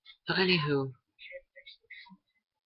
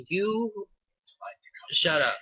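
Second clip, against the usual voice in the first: high "you", then a louder comeback; indignant retort; 7 semitones higher, 4 dB louder, much slower than usual. Retold or imitated. Answering a friend's teasing about Squirty, indignant retort. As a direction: fire back at teasing, mock offended. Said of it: "And I'm like".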